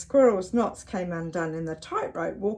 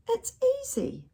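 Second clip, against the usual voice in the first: cooing. Acted. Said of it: "she cooed".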